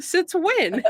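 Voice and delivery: high-pitched disbelieving question; mock incredulity. Host acting out the reply to a retirement claim, high mock incredulity. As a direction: shoot the pitch right up in disbelief.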